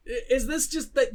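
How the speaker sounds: normal talking voice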